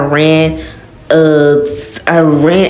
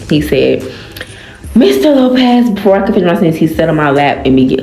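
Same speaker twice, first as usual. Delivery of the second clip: ordinary delivery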